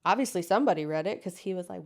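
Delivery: ordinary talk, nothing marked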